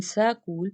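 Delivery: school said with an inserted A, sa-kool; epenthetic vowel. Shown as a demonstration. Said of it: "that is epenthetic vowel".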